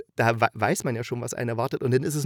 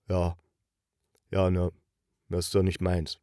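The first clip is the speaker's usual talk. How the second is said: lower, casual mock of an untrained actor, unbothered; lässiger Laiensprecher; 6 semitones lower than usual. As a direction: shrug it off like a non-actor reading lines. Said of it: "ich kann's nicht gut nachmachen". (tiefer, leicht nachgemachter gelangweilter Laiensprecher)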